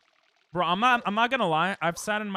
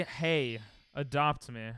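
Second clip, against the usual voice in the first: normal talking voice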